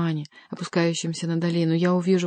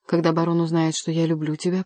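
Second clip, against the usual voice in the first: usual speaking voice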